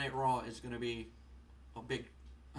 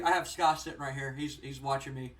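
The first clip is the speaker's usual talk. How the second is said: ordinary delivery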